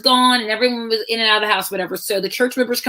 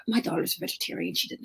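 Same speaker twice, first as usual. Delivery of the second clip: whisper aside; 12 dB quieter, faster than usual. Retold or imitated. Mother explaining quietly to friend.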